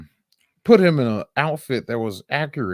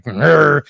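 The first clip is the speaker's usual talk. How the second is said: louder mock growl, like a cat about to pounce; half-playful angry growl; imitated growl; 5 semitones higher, 8 dB louder than usual. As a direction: growl it out, fists clenched. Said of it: "She'll growl at me".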